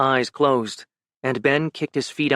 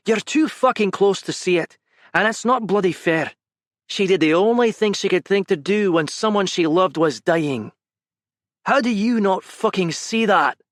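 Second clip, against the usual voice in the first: raised, intense reproach, higher pitch; heated scolding; 6 semitones higher than usual. Acted. Gavin confronting Ben about her, heated reproach. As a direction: berate him for not seeing it.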